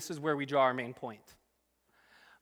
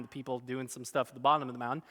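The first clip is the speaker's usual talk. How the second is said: normal talking voice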